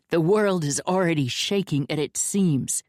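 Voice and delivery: ordinary delivery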